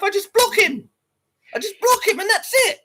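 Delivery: high, put-on whimpering voice; whiny mock voice. Streamer mocks a crybaby reaction, high whiny voice. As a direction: whine it like a crybaby.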